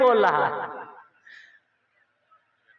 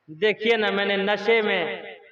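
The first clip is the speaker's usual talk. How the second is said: sheepish confession; 6 semitones lower, 6 dB quieter than usual. Retold or imitated. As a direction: own up meekly, eyes down. Sheepish drunk young man confesses. (धीमी, शर्मिंदा आवाज़ में कबूल करना)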